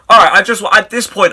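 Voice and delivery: ordinary delivery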